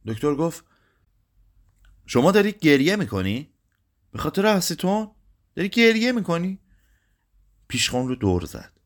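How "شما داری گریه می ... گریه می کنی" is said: high-pitched, disbelieving questions; incredulous question. A narrator's reading. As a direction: pitch climbing in astonishment at the tears. Doctor astonished that customer is crying. (با تعجب و ناباوری، صدای زیر)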